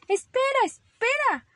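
very high, loud panicky plea; little rabbit yelping to stop her; crying out. Acted. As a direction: call out urgently, high and loud. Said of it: "gritó Ramón".

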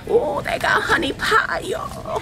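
high excited shout; excited squeal. Real, not acted. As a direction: squeal with delight at the find. Excited find in store aisle.

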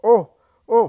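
the blind man suddenly seeing, crying out; joyous amazement breaking into a loud oh; joyful cry. Acted. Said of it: "He staggers in his joy".

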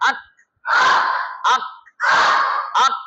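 আক cut short on a hard k; k ending. Shown as a demonstration. Teacher spells it out for class, hard-stopped আক. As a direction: stop dead on the k of আক. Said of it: "স্বরে আ ক কি হয়?".

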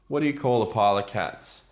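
usual speaking voice

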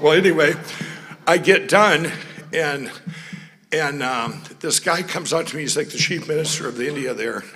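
ordinary delivery

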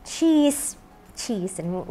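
usual speaking voice

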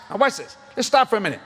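usual speaking voice

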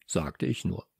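ordinary delivery, nothing marked

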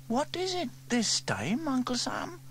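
Sarah eyeing her uncle's latest invention; suspicious girl. A narrator's reading. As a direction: ask it doubting him. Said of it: "asked Sarah suspiciously".

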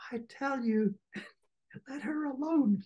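high, amused plea broken by a laugh; can't keep a straight face; laughing through Joe's plea. Acted. Reader cracking up voicing Joe telling Orlick to stop.

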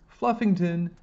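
high, loud shout; urgent high-pitched call. A narrator's reading. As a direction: shout the cat's name, pitched right up. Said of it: "Yasmin shouted at the same time".

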